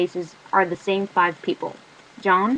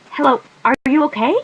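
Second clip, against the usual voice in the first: higher, louder call through the door; concerned call; 6 semitones higher, 7 dB louder than usual. Acted. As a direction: call out, worried about the crying girl. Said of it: "a girl calls from the hallway".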